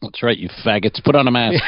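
ordinary talk, nothing marked

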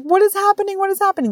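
high-pitched panicked kid, mimicked; imitated crying child. Little boy crying while being treated, high panicked wail. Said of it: "Montgomery is just crying".

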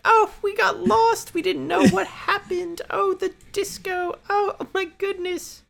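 very high, put-on airhead voice; dumb American act. Imitating her lost-tourist excuse to guards, dumb American act. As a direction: play a clueless American tourist, hammed-up and squeaky. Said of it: "dumb American act".

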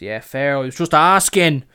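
hammed-up kid caught trying it on; impression of local kid. Refused kid backs off from buying cigarettes, loud mock protest. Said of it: "That's a fucking one-to-one impression".

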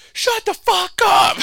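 very high, loud, drawn-out shout; hammed-up bellow imitating a fed-up dad; mock angry dad yell. Imitating her dad in the game, mock angry yell. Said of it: "just him, like, sitting there and, like, just be like".

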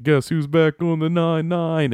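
imitated cocky return; Jake's grand entrance. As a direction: announce yourself, drawing it out. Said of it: "He's like".

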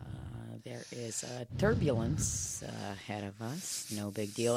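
low, calm captain's intercom voice; pilot announcement. Pretend. Mock pilot announcement on plane.

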